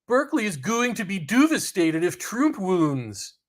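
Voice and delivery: a hammy fake Canadian accent, drawn out. Streamer quoting a chat comment in a mock Canadian accent. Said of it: "That's my, my Canadian accent".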